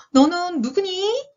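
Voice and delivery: high, slow, wondering voice; surprised question. A narrator's reading. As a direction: ask it in storybook astonishment. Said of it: "세양지가 놀라서 물었는데요".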